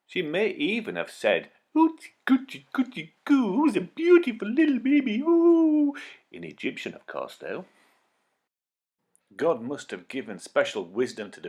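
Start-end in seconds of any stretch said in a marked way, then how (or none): 1.8-5.9 put-on high, cooing baby talk. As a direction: coo at the baby like a doting grown-up. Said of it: "talked to the baby the way many grown-ups do".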